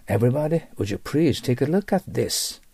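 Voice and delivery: slow, formal presentation voice addressing the room; business presenter. Shown as a demonstration. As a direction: invite the audience to look at the screen, unhurried. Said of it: "例えばこういう感じですね".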